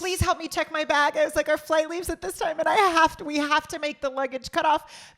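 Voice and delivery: high, loud retold plea; pressing and high-pitched, begging against the clock; urgent pleading. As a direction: act out a frantic request for help. Retelling urgent plea to curbside guy.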